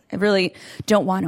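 ordinary delivery

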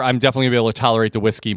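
usual speaking voice

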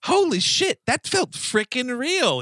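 high-pitched, drawn-out awe; hammed-up movie-quote astonishment; mock amazement. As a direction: imitate an over-amazed movie line. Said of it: "is what he says".

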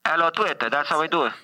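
ordinary delivery

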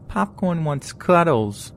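put-on high, drawn-out babyish whine. A narrator's reading. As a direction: put on a slow, high-pitched baby voice. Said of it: "says in a baby voice".

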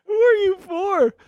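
high laughing question. Real, not acted. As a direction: ask through a laugh. Laughing at partner's rant.

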